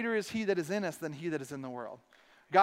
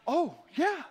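higher "oh yeah" of remembering; sudden realization; 8 semitones higher than usual. Retold or imitated. Pastor suddenly remembering to pray, higher and surprised. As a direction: catch yourself realizing. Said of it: "And I was like".

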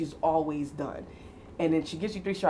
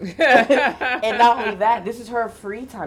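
laughing; 6 semitones higher, 9 dB louder than usual. Real, not acted. Laughing about mom's freedom.

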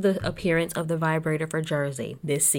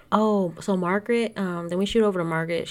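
ordinary talk

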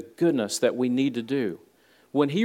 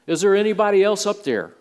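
mildly raised, higher calling voice; 7 semitones higher, 4 dB louder than usual. Acted. Man on the branch calls up past God, lightly acted call. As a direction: call upward as if hoping for another answer.

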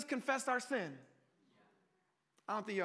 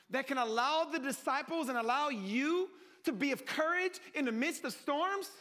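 usual speaking voice

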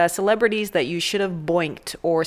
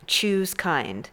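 normal talking voice

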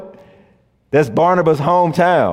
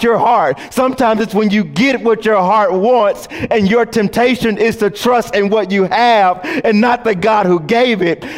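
preaching at full force; 7 semitones higher than usual. Acted. Pastor exhorting the congregation at full preaching force. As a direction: push each line up toward the climax.

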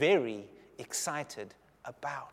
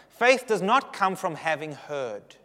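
ordinary delivery

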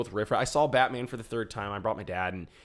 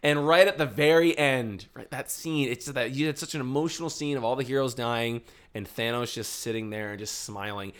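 ordinary talk

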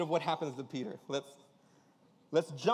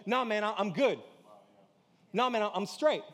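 dismissive brush-off; 6 semitones higher, 7 dB louder than usual. Retold or imitated. Brushing off a rebuke.

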